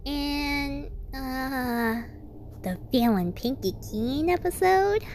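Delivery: high, bouncy, curious; squeaky chirpy question; Pinkie voice. Acted. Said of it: "Pinkie spoke out".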